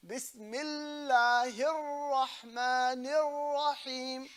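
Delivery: "Bismillahir Rahmanir Rahim" very slow, lam and both ras held long; drawn-out recitation of "Bismillahir Rahmanir Rahim", doubled letters stretched; held shaddas. Shown as a demonstration. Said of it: "You hold the lam".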